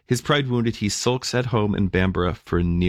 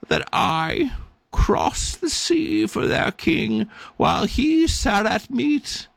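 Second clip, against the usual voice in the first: much higher, slow croaking bird voice; indignant raven; 10 semitones higher, slower than usual. Acted. Sulking raven answers the angel with wounded pride. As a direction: squawk out the injured pride of a neglected raven. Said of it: "the raven turned a yellow eye".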